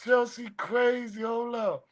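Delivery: high, drawn-out amused disbelief; slow, high, overcome with amusement; cracking up. Real, not acted. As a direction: reel from a joke, voice pitched high. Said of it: "I'm crying".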